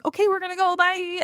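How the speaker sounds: high, sing-song announcement of leaving; breezy exit line. Acted. Mom announcing to the group that they're leaving, breezy and high. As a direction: pitch it up high for a light goodbye. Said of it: "You're just saying".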